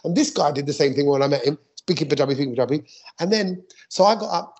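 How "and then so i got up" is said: ordinary delivery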